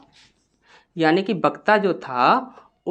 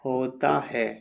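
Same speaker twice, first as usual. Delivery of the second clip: low, soft dictation with long gaps; quiet, measured note-taking voice; slow dictation; 6 semitones lower, 8 dB quieter, slower than usual. Shown as a demonstration. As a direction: dictate word by word, leaving long pauses.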